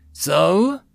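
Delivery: eager question. Acted. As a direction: ask it keen and expectant. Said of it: "excitedly leaning forward".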